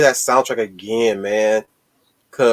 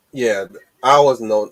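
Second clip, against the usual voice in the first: ordinary talk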